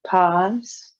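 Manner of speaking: spare breathing cues, spaced by long silences; guided breathwork. Real, not acted. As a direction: pace their breath with calm, measured cues. Leads timed breathing practice.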